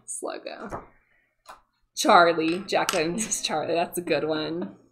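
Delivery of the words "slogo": Slogo whispered softly to herself. Real, not acted. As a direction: breathe Slogo as she writes it down. Noting the name down.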